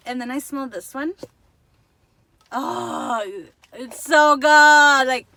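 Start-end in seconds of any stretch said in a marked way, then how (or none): 2.5-5.3 long, drawn-out delighted gush; a big slow swoon at a lovely scent. Real, not acted. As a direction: swoon loudly over the smell, stretching it slow. Gushing over a candle scent.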